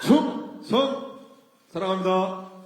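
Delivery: loud high salute shout; korean military style. Acted. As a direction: yell it like a soldier on parade. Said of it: "앞에서 다 하니까 저도 해야 될 것 같아요".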